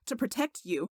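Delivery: plain reading voice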